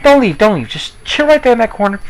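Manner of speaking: high, mock-suave address to the ladies; smooth talker to ladies. Retold line to hot chicks while fleeing the burning car, mock-suave and high. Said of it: "So I was like".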